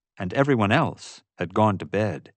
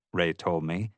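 ordinary delivery

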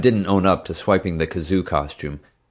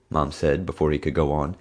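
ordinary delivery

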